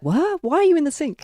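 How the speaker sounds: high, bewildered mock question; sudden high-pitched disbelief; surprised reader. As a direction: act baffled at the opening line. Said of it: "And you're immediately like".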